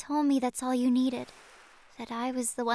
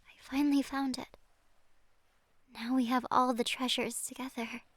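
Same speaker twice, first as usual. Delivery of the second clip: usual speaking voice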